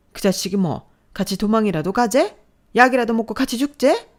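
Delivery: loud, high, berating yell; out-of-control rage. Acted. Mother scolding her daughter, furious yell. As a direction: tear into your daughter. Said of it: "자신의 화를 주체하지 못하는 모습".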